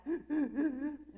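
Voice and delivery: mock ugly-cry gasp, loud and high; sobbing gasp. Acting out her ugly cry in the car, gasping. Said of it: "that ugly cry".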